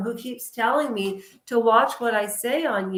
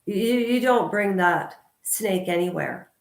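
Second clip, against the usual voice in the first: normal talking voice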